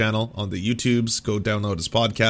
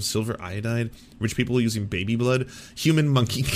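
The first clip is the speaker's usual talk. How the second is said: normal talking voice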